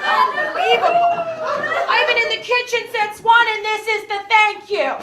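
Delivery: loud, high martyred complaint; guilt-trip the daughter; mock-wounded mother; aggrieved mom. Mother complaining about effort.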